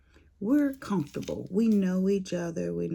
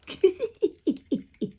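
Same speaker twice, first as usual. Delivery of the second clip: high laugh; 3 dB louder than usual. Real, not acted. Laughing at sister's joke.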